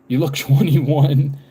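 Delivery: drawn out through a laugh. Real, not acted. Laughs reading a viewer's comment.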